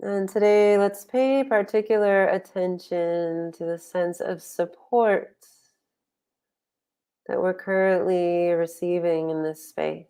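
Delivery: unhurried guiding voice with long pauses; guided meditation. Real, not acted. Meditation teacher settling online group into posture and breath, guided meditation. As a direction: ease them into stillness, phrase by phrase.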